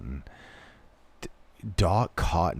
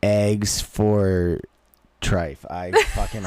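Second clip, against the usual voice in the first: ordinary delivery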